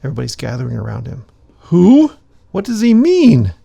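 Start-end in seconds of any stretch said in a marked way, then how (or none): 1.7-3.5 high, loud, excited questions; eager crowd voice. Acted. Gathering villager asking excitedly, high and loud. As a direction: burst in with curiosity.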